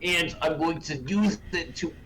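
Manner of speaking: high-pitched imitation of a reckless gambler; hammed-up epic quest declaration; mock heroic boast. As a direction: announce a grand plan with exaggerated bravado. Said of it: "and was like".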